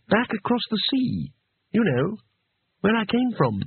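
high, sheepish explanation. A narrator's reading. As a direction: add details in a high, embarrassed voice. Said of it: "Two Flower explained sheepishly".

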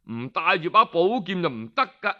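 plain reading voice

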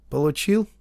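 plain reading voice

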